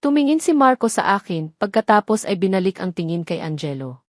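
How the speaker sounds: ordinary delivery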